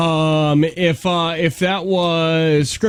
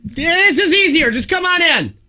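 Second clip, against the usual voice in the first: through a window; 12 semitones higher than usual. Pretend. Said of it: "they're talking through a window right now".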